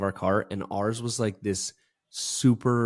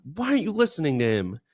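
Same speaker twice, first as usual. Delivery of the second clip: higher, raised mock yell at a golf partner; exasperated yelling, replayed for laughs; replaying a frustrated yell at a friend ignoring the caddy; retold yelling; 10 semitones higher than usual. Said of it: "I just kept yelling".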